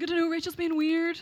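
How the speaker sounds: high, loud exasperated reply; Mary Claire. Retold or imitated. Roommate explains to the woken girls, high loud exasperation. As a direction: imitate a baffled roommate telling on her. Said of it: "Mary Claire's like".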